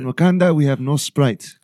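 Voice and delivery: put-on Wakandan accent, regal and measured. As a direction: speak like the king of Wakanda.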